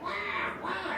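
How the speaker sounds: very high, soft imitated wail; high imitated Tasmanian devil cry; animal screech. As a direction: squeal like a small wild animal.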